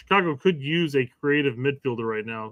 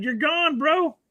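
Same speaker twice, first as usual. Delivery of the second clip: high dismissive brush-off; dismissive quote; 12 semitones higher, much slower than usual. Retold or imitated. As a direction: wave him off, breezy. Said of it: "it's practically said".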